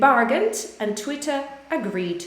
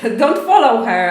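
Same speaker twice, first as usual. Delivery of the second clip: laughing while warning, a bit louder; 8 dB louder than usual. Real, not acted. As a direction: chuckle through the warning. Laughing warning about a politician.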